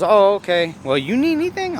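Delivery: high mock lady; lady voiced. Lady's voice, retold.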